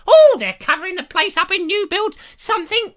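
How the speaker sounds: high, loud screech of a Monty Python housewife; screeching woman impression. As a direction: screech her name across the room. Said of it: "You don't really talk like that".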